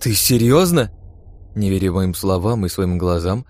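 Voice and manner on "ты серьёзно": higher, louder disbelieving question; can't believe what is being offered; surprised exclamation. A narrator's reading. Iren sees the gauntlet held out, surprised exclamation. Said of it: "удивлённо воскликнула Ирен".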